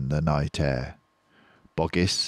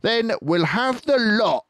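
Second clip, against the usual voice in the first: high, loud greedy farmer voice; Bean the farmer; 12 semitones higher, 5 dB louder than usual. Acted. Bean ordering shovels, high and greedy. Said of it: "said Bean".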